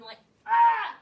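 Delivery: loud high "ah!" of effort; loud effortful shout; straining yell. Retold or imitated. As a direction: cry out as you force the handle. Straining to open scissors.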